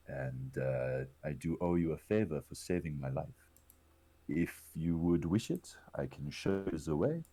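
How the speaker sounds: hesitant speech in a bad French accent; terrible French accent. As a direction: put on a hammy French accent. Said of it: "in a terrible French accent".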